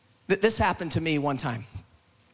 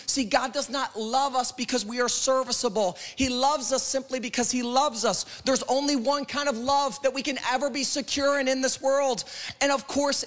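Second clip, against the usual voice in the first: urgent, driving sermon delivery; fervent preaching; 6 semitones higher than usual. Real, not acted. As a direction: press each promise home to the congregation.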